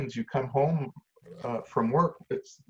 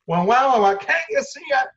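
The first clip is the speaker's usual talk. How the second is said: high, loud mock wailing and yelling; exasperated outburst; 11 semitones higher, 6 dB louder than usual. Wailing kid and parent on device, acted exasperation. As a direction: escalate into a frustrated shout.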